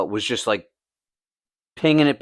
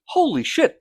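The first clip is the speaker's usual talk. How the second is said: shocked outburst. Retold or imitated. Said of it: "I'm like".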